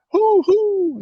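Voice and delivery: high hooting like an owl; owl hoots. Retold or imitated. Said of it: "they're like".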